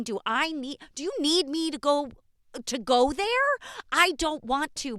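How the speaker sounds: retold teen outburst, loud and high, breaking into shouts; desperate shouting. Teen daughter shouting at her parents to be heard, desperate loud yelling. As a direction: yell it as if the parents still won't listen.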